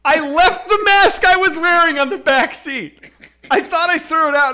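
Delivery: loud, high, sustained outburst; exasperated shouting. Real, not acted. Storyteller revealing his blunder to the host, exasperated. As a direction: yell the punchline in disbelief at yourself.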